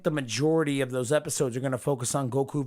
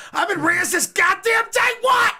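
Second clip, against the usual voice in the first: mock outburst; 9 dB louder than usual. Real, not acted. Playful outburst at friend.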